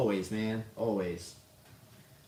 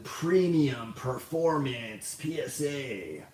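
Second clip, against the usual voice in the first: slow, drawn-out announcer voice mocking an ad; stupid radio announcer voice; 4 semitones higher, much slower than usual. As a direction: stretch each word like a cheesy radio promo. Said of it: "that stupid radio announcer voice".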